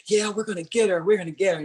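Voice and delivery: teammates promising payback, lightly voiced; eager teammates. Teammates vow to get the rival back, eager. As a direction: play the teammates vowing to get her. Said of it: "they're like".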